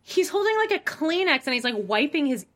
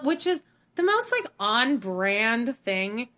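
usual speaking voice

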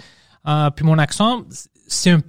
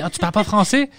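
higher-pitched incredulous question, imitated; challenging teacher; 6 semitones higher than usual. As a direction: mimic a teacher's disbelief. Retold teacher challenging pupil. (prof incrédule, imitée)

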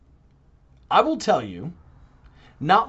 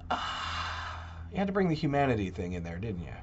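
sarcastic mutter; 6 semitones lower, 9 dB quieter than usual. Retold or imitated. Exasperated aside to the Pope.

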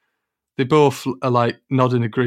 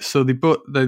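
ordinary talk

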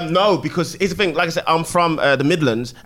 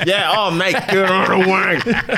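high, slow, drawn-out excuse me with laughs; mock-posh English traveller in New York, laughing; polite English tourist; 7 semitones higher, slower than usual. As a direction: put on an overly polite English voice. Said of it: "English accent as well".

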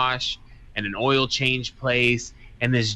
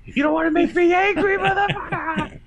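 high, loud, hammed-up threat; comic high-pitched rage, breaking into laughter; mock angry; 5 dB louder than usual. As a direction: squeal a fake-furious warning, stretching the last word. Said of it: "something that makes him angry".

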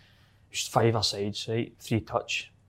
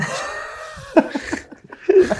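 high loud whooping laugh; real laughter; shouted laugh; 5 dB louder than usual. Real, not acted. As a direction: burst out laughing. Laughing at medal story.

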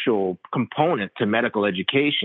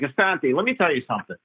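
Long Island Italian doctor; 5 semitones higher, faster than usual. Retold or imitated. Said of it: "typical L-, Long Island Italian doctor".

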